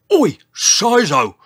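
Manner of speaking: loud, high, drawn-out roar. A narrator's reading. Old Triceratops storms out at the giant, roaring. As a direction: roar the name across the forest. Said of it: "he roared".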